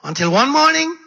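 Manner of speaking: loud, high "Until one morning," rising; dramatic rise. Real, not acted. Preacher lifting the story to its turning point, loud. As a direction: swing the voice up for the turn.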